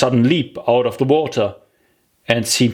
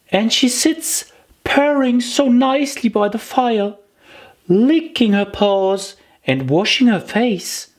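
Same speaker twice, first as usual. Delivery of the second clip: put-on higher girlish voice, fond and chatty; Alice coaxing; 12 semitones higher than usual. Alice telling the mouse about her cat, higher and fond. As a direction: sweet-talk the mouse about Dinah.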